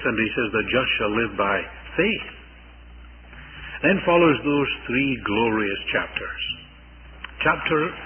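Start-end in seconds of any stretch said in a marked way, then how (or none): 0.5-2.3 "faith" lifted and held at the end; stress on faith. Shown as a demonstration. Preacher quoting the writer to the Hebrews, stress on "faith". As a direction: land the weight on "faith". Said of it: "he puts the emphasis on the faith".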